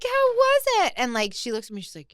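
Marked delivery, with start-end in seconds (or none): 0.0-0.9 much higher, louder sing-song question; chirpy instructor. Retold or imitated. Mimicking the perky instructor asking after class. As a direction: imitate a perky teacher asking how it went.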